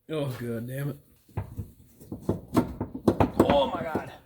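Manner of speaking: normal talking voice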